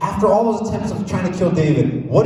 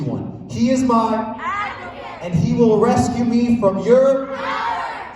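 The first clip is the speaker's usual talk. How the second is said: slow, raised proclamation; higher, slower solemn reading; declaiming the verse; 8 semitones higher, slower than usual. Acted. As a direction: proclaim it like scripture to the king. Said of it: "the verse that David said".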